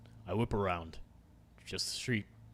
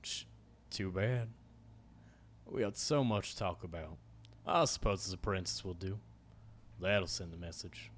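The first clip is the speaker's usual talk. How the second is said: cowboy voice; 3 semitones lower, 5 dB quieter than usual. Acted. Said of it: "my cowboy voice".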